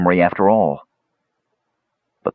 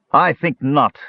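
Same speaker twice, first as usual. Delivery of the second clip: spat out; 7 semitones higher than usual. A narrator's reading. Kishpa answers Tanis, spat out. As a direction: spit the words, higher. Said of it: "Kishpa spat out".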